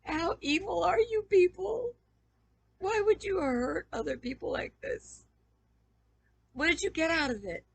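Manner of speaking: loud, high, tearful outcry; anguished accusation. Real, not acted. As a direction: cry out in hurt and outrage. Anguished accusation of former friends.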